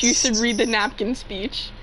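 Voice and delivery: normal talking voice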